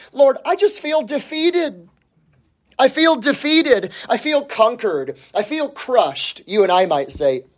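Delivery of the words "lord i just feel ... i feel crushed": defeated lament. Acted. Voicing the feeling of defeat.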